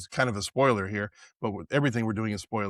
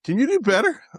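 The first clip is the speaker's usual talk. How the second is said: pitched way up, sneering "can you do better"; high mocking taunt; 10 semitones higher, 4 dB louder than usual. Co-host mimicking the bully's taunt, high and sneering. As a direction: jeer it like a schoolyard bully.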